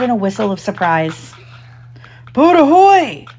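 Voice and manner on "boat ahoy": high, loud captain's hail; big swooping hail to a boat; ringing call. A narrator's reading. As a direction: holler across open water as a pirate. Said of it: "came the voice again".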